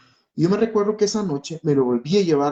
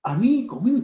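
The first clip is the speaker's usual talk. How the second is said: high-pitched pleading protest, retold; reproaching God; 8 semitones higher than usual. Father reproaching God over his sick child, high anguished protest. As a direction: re-enact a wounded complaint in a raised pitch. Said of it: "empecé a reclamarle a Dios".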